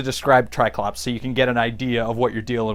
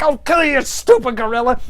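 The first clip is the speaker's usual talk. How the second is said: higher hammed-up menace; lightly voiced tough-guy threat; mock threat; 7 semitones higher than usual. Triclops threatening Beastman against the wall.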